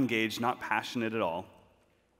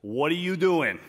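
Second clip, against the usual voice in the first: lightly imitated New York coach accent, louder; 4 semitones higher, 6 dB louder than usual. Substitute coach calling out the student, mock New York accent. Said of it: "He's, like, from New York or Boston in my head".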